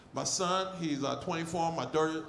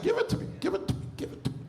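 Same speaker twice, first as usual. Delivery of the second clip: eager begging; 11 semitones higher, much faster than usual. Retold or imitated. Eager quote to doctor.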